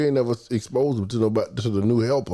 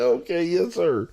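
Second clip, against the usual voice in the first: laughing reply; 9 semitones higher, slower than usual. Retold or imitated. Answering the caller, laughing.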